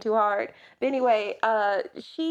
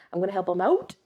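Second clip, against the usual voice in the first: put-on Canadian accent; much faster than usual. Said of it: "I think it's Canadian".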